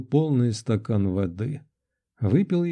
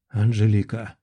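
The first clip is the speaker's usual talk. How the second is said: understated, lower-pitched rasp; hoarse croak; 3 semitones lower than usual. A narrator's reading. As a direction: croak the name on waking from horror. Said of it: "прохрипел я вслух". (хрипло, пониженным голосом)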